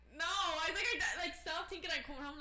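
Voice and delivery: high, loud retold protest; exasperated denial. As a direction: insist over the phone, exasperated. Acts out insisting to mother.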